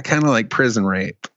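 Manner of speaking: ordinary talk, nothing marked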